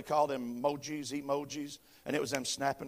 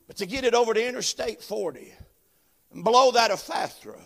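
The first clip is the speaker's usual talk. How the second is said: louder, higher preacher's cadence with "uh" breaths; full-force preaching; 10 semitones higher, 15 dB louder, slower than usual. Acted. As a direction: build it up like a revival preacher. Preaching rises to shouting.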